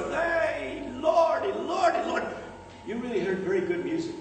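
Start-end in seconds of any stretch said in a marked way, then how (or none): 0.1-2.2 very high, slow, drawn-out shout of joy; rejoicing shout. Retold or imitated. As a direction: stretch each Lordy in delight. Grandparents rejoicing at Juilliard news.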